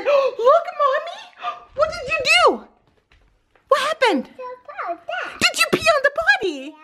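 put-on high, delighted toddler-directed voice; excited baby talk. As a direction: gush like a thrilled mom. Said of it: "she just said".

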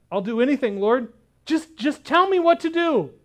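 plead, ready to obey; dangling man begging for instructions; eager pleading. Acted. Jack begs.

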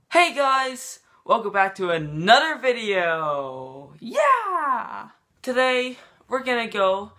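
hyped intro. Acted. Vlogger welcoming fans to another video, hyped intro. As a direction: open like an overhyped vlogger.

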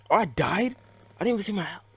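high, loud and incredulous; shocked disbelief. Real, not acted. Gamer sees he died in the match, shocked disbelief.

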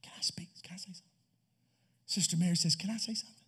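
soft, hesitant whisper; timid whisper. Acted. Sister Mary whispering a question to the family. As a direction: ask permission in a whisper. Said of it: "Sister Mary begin to whisper".

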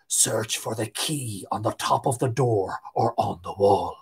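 low, soft hollow voice from the dead; hollow corpse voice. A narrator's reading. Corpse speaking softly into Tig's ear, low and hollow. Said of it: "a voice in his ear said".

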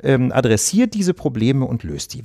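usual speaking voice

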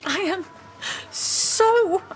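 high, indignant outburst; angry. Acted. Ranting about a cancelled holiday to Lapland, angry. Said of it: "so angry".

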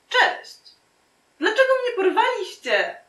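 very high, squeaky-pitched heroine; flustered girl. Acted. Kidnapped girl greets her famous captors, flustered. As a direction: put on a high little voice.

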